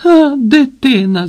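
loud, high, drawn-out jeer; mocking hoot of henchmen; guffawing mockery. A narrator's reading. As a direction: scoff loudly with a slow, high 'ha'. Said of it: "зареготали челядники".

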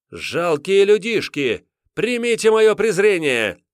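high, loud, slow contemptuous boom; booming arrogant alien overlord. Acted. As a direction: look down on pitiful humans. Witch scorns crusaders on wheel.

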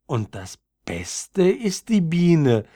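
high, slow, timid little violet voice; shy addition. A narrator's reading. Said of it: "setzte es schüchtern hinzu". (schüchtern, mit hoher Stimme)

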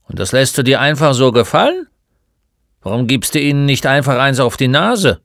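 lightly voiced crusty old man, provoking; challenging old shopkeeper. Old man challenges the bullied boy to fight back, loudly.

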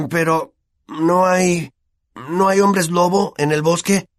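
slow, hesitant start rising into a worried question; nervous hesitation. A narrator's reading. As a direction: stall on the first words, then ask uneasily. Ron nervously asks about werewolves.

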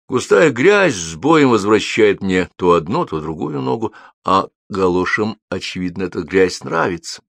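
plain reading voice

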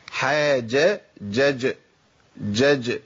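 slow "حاجة", then "جج" with echoed jim; qalqala drill. Shown as a demonstration. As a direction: isolate "جج", releasing the still jim. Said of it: "تحقيق القلقلة".

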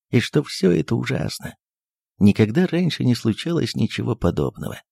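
usual speaking voice

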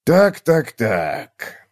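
musing "so, so", falling away at the end; thoughtful repeating. A narrator's reading. Old gnome looking over book spines, thoughtful. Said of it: "задумчиво повторял он".

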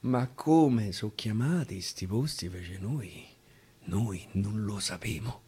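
ordinary delivery, nothing marked

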